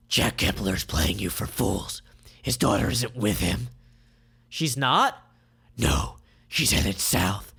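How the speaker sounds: low, slow villain; Grosvenor's voice. Acted. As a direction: reveal the trick slowly.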